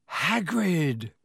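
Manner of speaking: hoarse cry of relief; relieved croak. Acted. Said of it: "Harry croaked in relief".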